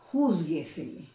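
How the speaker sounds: "juzguesele" with JUZ hit hard; stress on first syllable. Shown as a demonstration. Teacher showing a sobresdrújula example, "juzguesele" stressed on JUZ. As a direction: punch JUZ in "juzguesele", then fall away. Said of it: "donde lleva la mayor intensidad".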